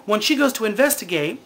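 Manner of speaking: ordinary delivery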